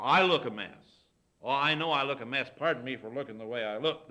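lightly hammed-up apology, loud at the start; mock embarrassed apology. Women apologizing to the visitor for their looks, mock embarrassed. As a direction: imitate an embarrassed host, punching the first words.

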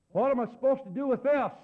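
high, loud blurted question. Retold or imitated. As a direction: blurt it out for the whole room. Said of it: "He says out loud".

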